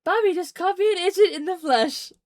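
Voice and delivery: high, thrilled and squealing; excited. Real, not acted. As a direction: gush about being called an idiot. Excited fangirl retelling.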